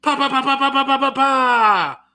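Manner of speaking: high, loud burst of pa-pa-pa; celebratory mouth noise, high and building; rapid-fire "pa" sounds. Pretend. Celebrates being in the groove.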